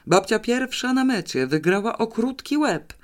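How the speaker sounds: high-pitched gleeful cheering; delighted. A narrator's reading. Grandson cheering grandmother's win, delighted and high. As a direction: announce the winner with boyish glee. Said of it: "ucieszył się Pawełek".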